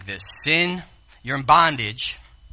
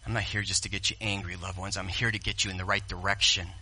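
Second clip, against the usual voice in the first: usual speaking voice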